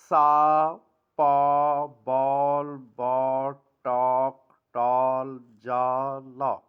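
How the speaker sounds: loud, rounded aw in "سا، پا، بال", fading down; loud drill of example words; vowel demo words. Shown as a demonstration. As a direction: call out each word loudly with rounded lips. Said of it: "مڑتے ہیں".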